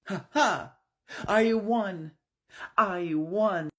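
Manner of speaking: high, loud gloating; triumphant crow. Acted. Bloodied officer gloats over his win, loud and high. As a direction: crow over the beaten rival. Briscoe wins the bloody fight.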